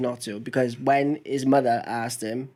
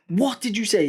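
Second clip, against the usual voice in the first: stern dad; 7 semitones higher than usual. Retold or imitated. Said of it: "I went".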